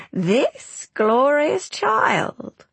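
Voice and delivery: high, slow, mocking sweetness; mock sweet crone. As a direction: drag it out in false adoration. Crone mocks the queen.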